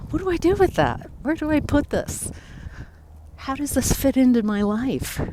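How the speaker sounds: high, baffled self-questioning; bewildered inner questions. Retold or imitated.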